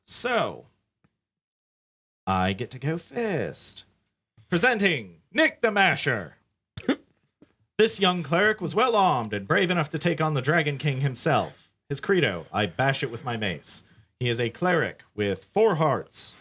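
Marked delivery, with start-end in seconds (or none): 4.5-16.3 announcer voice. Acted. Announces hero like a presenter.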